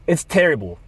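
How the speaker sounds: ordinary delivery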